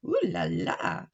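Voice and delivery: ooh la la. Acted. Woman admires hat.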